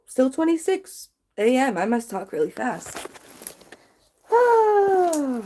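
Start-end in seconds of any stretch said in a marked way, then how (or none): 4.3-5.4 high startled gasp; surprised oh. Real, not acted. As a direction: jump with a sudden oh. Sudden startled exclamation.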